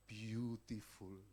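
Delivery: slow, drawn-out admiring beautiful; admiring doctor. Retold or imitated. Doctor admiring the surgery scars, slow and admiring. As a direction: savour the word like a pleased surgeon. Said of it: "Dia ngeliatin".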